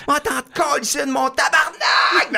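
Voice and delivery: high, loud Québécois cursing yell; furious swearing shout. Retold or imitated. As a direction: scream curses while chasing him. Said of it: "en criant puis en sacrant en québécois".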